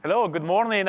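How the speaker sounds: normal talking voice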